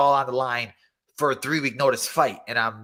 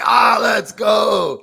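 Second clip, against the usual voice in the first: high, loud, slow stretched-out cheer; hype shout; 9 semitones higher, 9 dB louder, much slower than usual. Retold or imitated. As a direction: yell it like the crowd just erupted. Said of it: "I was like".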